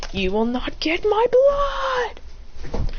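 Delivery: slow, rising defiant yell; defiant shout. Acted. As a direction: stretch it into a high battle shout. Hero defying the villain.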